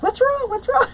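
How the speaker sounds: mock the husband's startled what's wrong; alarmed husband. Husband hearing her scream, voiced as high alarmed asking.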